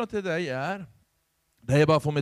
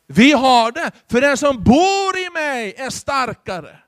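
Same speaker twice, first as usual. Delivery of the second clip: louder, rising preacher's proclamation; full-force preaching; 5 semitones higher, 8 dB louder than usual. Acted. Pastor to his congregation, preaching out loud and fervent. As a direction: proclaim victory over darkness to the congregation.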